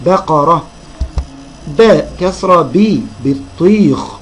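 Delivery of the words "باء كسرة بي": slow spelling drill, باء كسرة then a long "bii"; teacher demonstrating "bii" slowly after كسرة; letter with kasra. Shown as a demonstration. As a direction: sound out بي with a drawn-out ee. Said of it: "الباء هتتنطق ازاي في كل كلمة".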